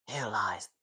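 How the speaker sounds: whispered documentary opening; hushed nature narrator. Shown as a demonstration. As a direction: whisper like a wildlife presenter. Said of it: "David Attenborough impersonation".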